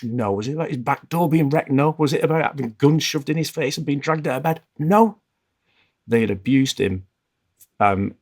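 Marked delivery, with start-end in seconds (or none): 4.8-5.2 high, loud emphatic no; a sharp loud no as the twist. Retold or imitated. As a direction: land the punchline no hard. Punchline of retold complaint.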